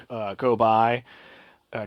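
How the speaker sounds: normal talking voice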